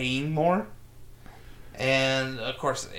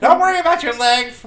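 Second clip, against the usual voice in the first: much higher, louder Boston mother; Boston mom impression; 12 semitones higher, 12 dB louder than usual. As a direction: brush off the leg like an overbearing mom. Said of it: "That's a pretty good Boston mom".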